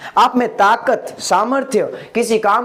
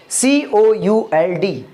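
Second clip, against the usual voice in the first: letters spelled out slowly; slow spelling out; much slower than usual. Shown as a demonstration. Teacher spells the word letter by letter for students. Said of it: "कुड पे।".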